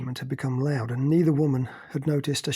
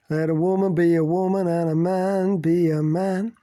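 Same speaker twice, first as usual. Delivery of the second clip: sung in a hammed-up American drawl, louder; exaggerated American drawl; 4 semitones higher, 6 dB louder than usual. A narrator's reading. Bindi from across the room, singing in an American drawl. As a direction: croon it like an overdone American. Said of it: "Bindi sang in an exaggerated American drawl".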